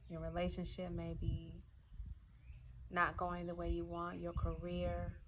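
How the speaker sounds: soft, hushed meditation voice with long pauses; quiet breathing instructions, left to settle in silence; guided meditation. Acted.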